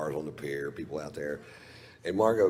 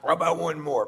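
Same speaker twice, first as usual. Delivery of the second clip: eager; 9 semitones higher, 6 dB louder than usual. Retold or imitated. Playing himself ordering more.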